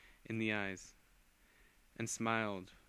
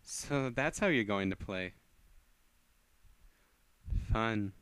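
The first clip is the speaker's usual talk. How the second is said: slow, relishing the fight; amused menace; slower than usual. Acted. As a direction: purr it out with an evil smirk. Villain amused by challenge.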